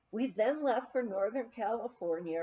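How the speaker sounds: usual speaking voice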